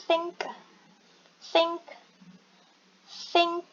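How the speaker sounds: "think" with a clearly released final k; pronunciation demo. Shown as a demonstration. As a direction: sound out the k ending "think". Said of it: "Ada k gitu belakangnya". ("think" dengan bunyi k di akhir)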